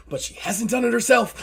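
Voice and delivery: anxious quip. Acted. Driver's worried parting quip.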